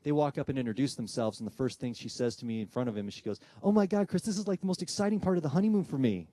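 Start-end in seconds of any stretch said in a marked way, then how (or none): 3.6-6.3 high, excited woman's voice; gushing bride. Retold or imitated. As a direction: gush in a high-pitched thrill.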